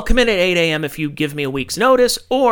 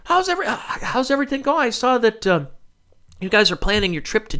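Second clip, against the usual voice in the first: much higher mock-friendly coworker chatter; mock coworker small talk; 6 semitones higher than usual. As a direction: parody the fake-cheerful meeting small talk, pitched way up. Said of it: "The first ten minutes of the meeting are like".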